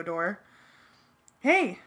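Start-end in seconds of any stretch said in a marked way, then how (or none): 1.4-1.8 high, loud call for attention; sharp raised holler; loud yell. A narrator's reading. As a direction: shout out to the crowd. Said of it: "Cassie yelled as loudly as she could".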